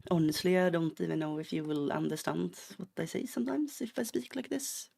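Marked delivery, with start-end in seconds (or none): 3.3-4.9 hammed-up Swedish accent, lifting higher at the end; extremely Swedish accent. Shown as a demonstration. Streamer showing viewers how hard they are to understand, thick Swedish accent. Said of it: "extremely Swedish accent".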